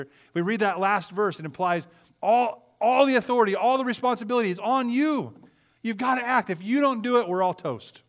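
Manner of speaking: escalating sermon cry; preaching at full force. Acted. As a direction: drive it home from the pulpit. Preacher building to a climax.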